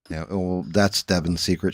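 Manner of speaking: usual speaking voice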